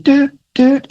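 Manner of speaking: high-pitched "doot" monitor beeps; little sung-out machine beep; imitated hospital beeps. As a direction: mimic a hospital machine beeping. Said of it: "like, the hospital noises".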